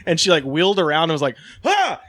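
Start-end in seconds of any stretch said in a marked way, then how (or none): 1.7-2.0 mock startled yelp. Security lady startled.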